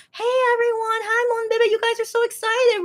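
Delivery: high bubbly intercom announcer voice; cheery announcer. Retold or imitated. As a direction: hype the crowd like a peppy host. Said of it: "producer goes on the intercom and says".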